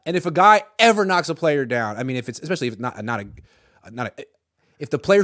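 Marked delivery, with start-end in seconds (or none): none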